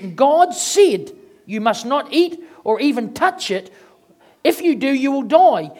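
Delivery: preacher reading Genesis to the church; scripture reading. Acted. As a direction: read the scripture out with force.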